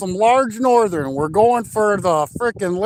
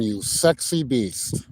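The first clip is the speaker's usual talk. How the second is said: mock sultry; 10 semitones lower, 6 dB quieter, slower than usual. Mock greeting to viewers.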